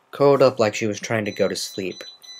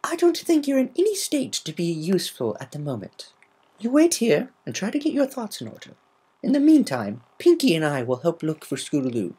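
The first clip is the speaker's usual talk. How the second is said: a polite lady explaining gently; Rarity voice; 8 semitones higher than usual. Acted.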